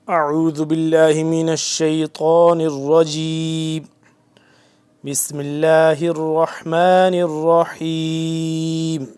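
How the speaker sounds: slow, loud, drawn-out chanting; tajweed recitation. Acted. Quran teacher beginning the day's verses, slow chanted recitation.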